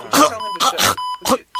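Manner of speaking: high, theatrical hacking cough; hammy cough. Acted. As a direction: cough like a dying king. Dying king coughs.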